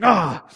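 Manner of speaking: acted-out burst of exasperation; exasperated groan. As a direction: let out a fed-up parent's "ah". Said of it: "We're grumbling right there".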